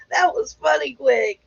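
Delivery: high, real laughter in the voice; amused, laughter-squeezed words; cracking up. Real, not acted. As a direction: say it while still getting over a laugh. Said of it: "You had me cracking up".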